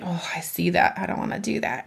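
put-on soft, sulky little-kid voice; mother acting her lazy inner child, quietly; whiny five-year-old. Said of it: "like, a five-year-old".

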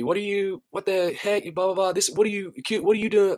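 high-pitched mock indignant rant; retold cursing. Homeless lady cursing at the youth director, mock indignant and high. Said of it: "begins to curse at me".

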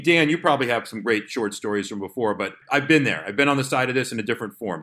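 ordinary talk

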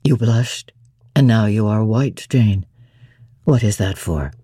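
deep, low, doting voice; Rochester, fond. A narrator's reading. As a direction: croon it low to his bride.

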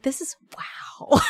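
slow, breathless whispered wow; awed whisper. Real, not acted. Overwhelmed by fan messages, a slow awed whisper.

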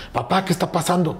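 lightly voiced questioning daughter; daughter's question.

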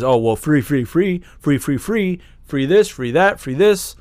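high, loud mock glee. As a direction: parody a naive voter gushing. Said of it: "what you're saying is".

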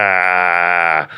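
long, level "ah" mimicking Chicago vowels; nasal accent noise. Shown as a demonstration. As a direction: drone the ugly Chicago vowel. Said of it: "I don't know, it sounds".

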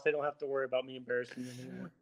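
normal talking voice

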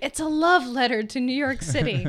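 teasing echo of a catchphrase; mock quoting. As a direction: say it like a repeated slogan. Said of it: "because we said that so many times".

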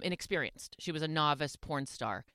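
ordinary talk

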